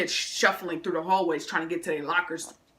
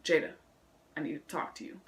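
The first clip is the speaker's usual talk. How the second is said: principal; 3 semitones lower, 9 dB quieter than usual. Retold or imitated. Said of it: "He says".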